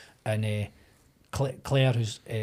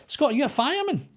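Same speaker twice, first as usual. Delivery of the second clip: teasing question; 12 semitones higher than usual. Retold or imitated. Makeup lass teasing, mocking quote.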